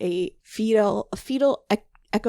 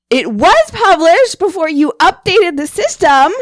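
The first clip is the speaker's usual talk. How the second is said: heated yelling; 6 semitones higher, 11 dB louder than usual. Retold or imitated. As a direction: yell it back at the caller, hammering each word. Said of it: "You were very heated".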